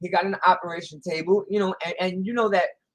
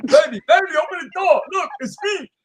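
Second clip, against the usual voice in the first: high, eager shout; excited calling out; 9 semitones higher, 7 dB louder than usual. Acted. Man back home shouts to wife to open up, excited. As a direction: call through the door, loud and high.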